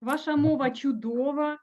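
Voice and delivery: ordinary talk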